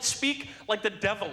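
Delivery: usual speaking voice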